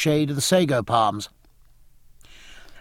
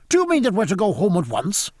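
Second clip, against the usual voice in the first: angrily; 6 semitones higher, faster than usual. Acted. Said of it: "cried Stephen angrily".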